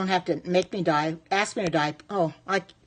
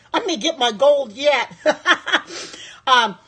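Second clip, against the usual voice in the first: laughing through the words, a bit louder; laughing delight; 4 semitones higher, 4 dB louder than usual. Real, not acted. Thrilled by two thousand viewers, laughing as she speaks. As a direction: giggle it out, thrilled.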